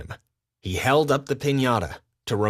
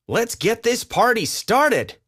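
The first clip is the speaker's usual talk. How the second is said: high, loud and lively; rally the crowd; hyped-up party starter; excited party call; 11 semitones higher, 3 dB louder than usual. Acted. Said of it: "he said".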